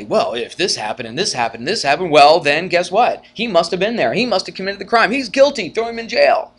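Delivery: building mock courtroom lawyer, rising pitch to loud end; lawyer's argument. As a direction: play the lawyer piling up his case. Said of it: "And they'll say".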